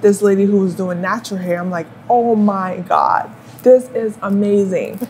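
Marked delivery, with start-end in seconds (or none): none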